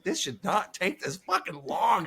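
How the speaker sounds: slow, high exasperated complaint; mock-exasperated at the long meeting. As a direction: drag it out in frustration. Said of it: "I'm just like".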